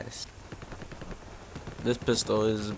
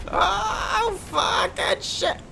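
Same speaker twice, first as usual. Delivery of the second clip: very high cry breaking into a breathy gasp; panicked shriek; much slower than usual. Real, not acted. Player caught by surprise, shrieking in alarm. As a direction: scream as you get hit. Shriek at sudden in-game hit.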